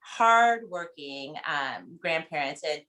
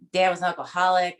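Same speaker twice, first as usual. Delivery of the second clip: normal talking voice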